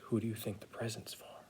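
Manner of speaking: soft unvoiced whisper to the kids. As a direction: lean in and whisper the question. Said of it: "whispered aside to children".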